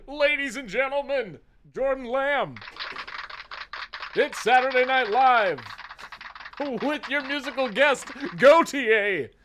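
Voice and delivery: high, loud showbiz intro; mock announcer. Introducing Jordan like a Saturday Night Live announcer. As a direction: boom it like a TV host.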